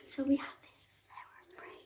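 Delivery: hushed whisper so mom can't hear. Real, not acted. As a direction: whisper it secretly. Hiding the sauces from mom.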